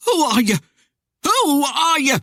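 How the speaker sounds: high, loud, rising panic; frightened demand. A narrator's reading. Frightened man demands the rider's name, panicked. Terrified man questions the spectre.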